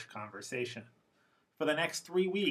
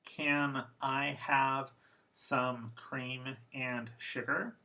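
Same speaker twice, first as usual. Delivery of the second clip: slow, careful formal English; much slower than usual. Shown as a demonstration. Teacher reading the formal version slowly. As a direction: say each word fully and slowly. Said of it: "First formal".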